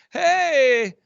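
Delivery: high, drawn-out hammed-up hello; mock overblown greeting. Coach hailing a client at a conference, mock overblown greeting. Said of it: "I don't feel like I go, I, I would say".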